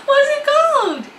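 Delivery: very high, loud squeal of frustration; exasperated wail. Real, not acted. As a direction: wail it at your own blank memory. Cannot recall the product's name.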